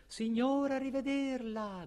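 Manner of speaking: high, soft call from far off; thin-volume, high faraway call; distant shouted farewell. Acted. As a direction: cry goodbye into the wind from afar. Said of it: "gridò di lungi ai venti".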